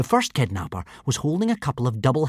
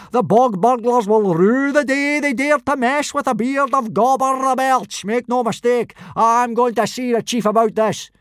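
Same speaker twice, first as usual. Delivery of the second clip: blood rage; 12 semitones higher, 4 dB louder than usual. A narrator's reading. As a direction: roar out the vow.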